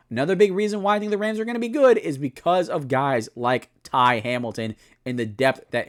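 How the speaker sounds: normal talking voice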